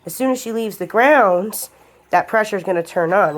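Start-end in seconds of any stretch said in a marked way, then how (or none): none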